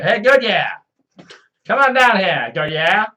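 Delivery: loud, high holler to someone far away; pretend calling out. Calling a man named Goodyear over, pretend holler. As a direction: holler the name like summoning a child.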